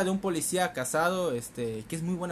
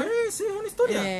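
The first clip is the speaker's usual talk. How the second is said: high, fast and excited; rushed, high-pitched gush; mock gushing; 6 dB louder, faster than usual. Real, not acted. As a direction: gush it out quickly.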